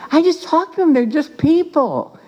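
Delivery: high-pitched, incredulous retold answer; protesting reply. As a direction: pitch it up as if stating the obvious. Acted protesting reply to colleagues.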